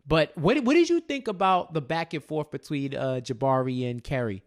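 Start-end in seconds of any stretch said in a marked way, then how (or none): none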